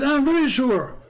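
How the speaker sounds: high-pitched mock confidence; emphatic certainty. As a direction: imitate a questioner totally sure of himself. Questioner insisting he is sure.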